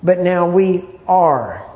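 "are" held long and set apart at the end; slow, weighty reading that lands on "are"; stress on are. Shown as a demonstration. Teacher moves emphasis along the verse, stress on "are". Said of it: "read it with a different emphasis".

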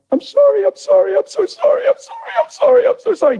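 very high, rapid mimicked pleading; mock tearful wife, squeaking out apologies; crying wife. Wife running at husband to apologize, imitated high crying voice. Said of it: "And she's crying".

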